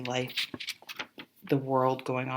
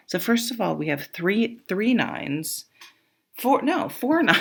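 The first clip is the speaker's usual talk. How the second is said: excited; 6 semitones higher, 5 dB louder than usual. Real, not acted. Excited counting the nines.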